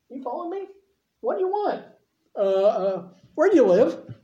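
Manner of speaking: high, halting, flustered asking; mock nervous squeak. Preacher voicing disciples as they nervously ask Jesus, in a high flustered squeak. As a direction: stammer it out like a shy follower. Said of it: "And Jesus is like".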